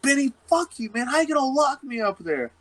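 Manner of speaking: hammed-up imitation of a furious friend; mock outraged. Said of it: "And Drewby's like".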